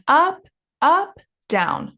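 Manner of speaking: illustrate the tune, rising on "up, up" and falling on "down"; two rising "up"s, then a falling "down"; up, up, down intonation. Shown as a demonstration. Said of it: "the last one is always down".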